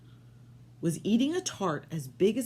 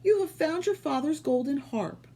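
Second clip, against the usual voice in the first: much higher delighted voice; mother happy; 10 semitones higher, 3 dB louder than usual. Acted. Mother greeting Jack happily, higher. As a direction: sound overjoyed. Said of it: "she said happily".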